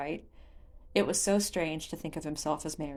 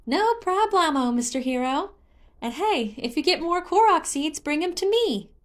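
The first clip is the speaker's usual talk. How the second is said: high, bright-voiced forest spirit, much higher and louder; a chirpy little creature showing off; singsong Hestu voice; 11 semitones higher, 7 dB louder than usual. A narrator's reading. Hestu speaks, voiced character.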